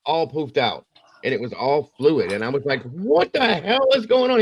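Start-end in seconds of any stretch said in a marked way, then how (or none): 2.8-4.4 high-pitched, re-enacted alarm; alarmed outburst. Dad sees his son's swollen head, alarmed outburst. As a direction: blurt it out high, in mock horror. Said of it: "I scared him when I did".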